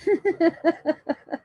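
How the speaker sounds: high run of giggles; guilty laughter. Real, not acted. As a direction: giggle when you know you shouldn't. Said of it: "I shouldn't laugh".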